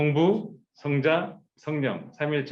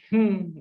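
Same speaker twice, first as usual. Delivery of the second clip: scornful humph; 7 semitones higher, 4 dB louder, much slower than usual. Retold or imitated. God scoffing.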